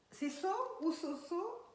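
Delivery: ordinary talk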